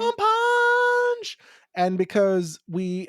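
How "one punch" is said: very high, long-held squealed cry; playful falsetto battle cry; attack call. Acted. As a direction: stretch the last word out way up high.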